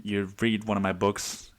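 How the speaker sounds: ordinary talk, nothing marked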